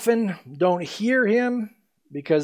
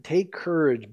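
normal talking voice

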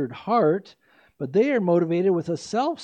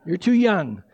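laughing; 4 dB louder than usual. Real, not acted. Teasing the audience while laughing.

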